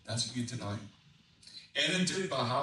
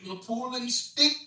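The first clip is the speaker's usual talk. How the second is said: rollicking Cajun rhyme, rising in pitch; Cajun dialect reading; 3 semitones higher than usual. Acted. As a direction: tell it in Cajun, getting more worked up. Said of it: "an expert on Cajun dialect".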